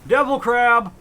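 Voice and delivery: loud, high exclamation; excited shout. Real, not acted. Gamer spotting a new catch, excited shout. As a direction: shout it out twice, high and thrilled.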